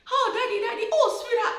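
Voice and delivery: high, eager welcome home; excited greeting. Retold or imitated. Wife and children greeting daddy at the door, high and eager. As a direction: gush with glee.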